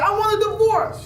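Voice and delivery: high, loud outburst from an upset wife; mock wife shouting for a divorce; angry wife. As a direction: shriek it like a wife storming off. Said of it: "Maybe your wife get mad".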